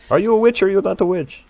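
normal talking voice

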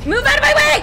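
high-pitched, loud yell; frantic scream of an order; panicked shout. Real, not acted. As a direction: shout to clear the path while fleeing. Fleeing zombies in game.

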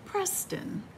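usual speaking voice

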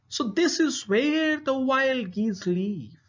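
high pleased hunter voice; hunter to himself. A narrator's reading. Hunter finds the geese's tree, pleased. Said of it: "he said to himself".

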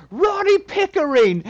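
slow, shouted name call; meme yell. Pretend. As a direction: bellow it like the famous video. Yelling the meme name on sight.

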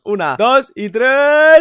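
loud, drawn-out count; countdown build-up. Pretend. Counting down to the costume transformation, loud and building. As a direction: stretch the count out loud and high.

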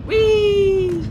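loud, high, sustained whee; high excited whee. Real, not acted. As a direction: let out a long squealed whee. Celebrating raid.

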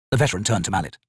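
ordinary delivery

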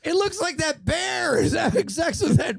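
hammed-up horrified mom; mock shocked parent. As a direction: point it out to your children in a high voice. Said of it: "Let's act it out".

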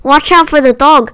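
high, mild cry of warning; lightly urgent storybook cry. A narrator's reading. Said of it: "cried the sheep".

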